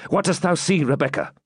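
mild insistent demand; demanding knight. A narrator's reading. Wounded knight questions maiden at the lattice, mildly demanding. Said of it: "again demanded the wounded knight".

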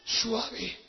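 slow, hushed delivery; soft voice. Retold or imitated. Preacher describes the freed girl, soft slow voice. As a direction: stretch it out gently and softly. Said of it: "con voz suave".

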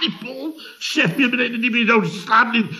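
high-pitched scornful parrot of a colleague; mocking his tone. Chef mimicking Paul's report to Lien, high and mocking. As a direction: throw his words back in a silly high voice. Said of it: "Die toon".

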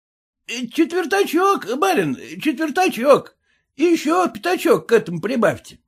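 high-pitched, wheedling peasant voice; haggling peasant, pitched well up; pleading peasant. A narrator's reading. Red-faced peasant begging for more money.

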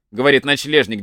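ordinary delivery

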